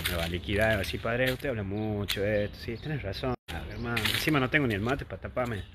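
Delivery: ordinary delivery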